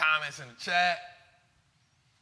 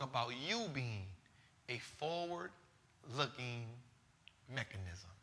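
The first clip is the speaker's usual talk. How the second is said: slow and soft; 2 semitones lower, 8 dB quieter, slower than usual. Real, not acted. Closing wise words to listeners.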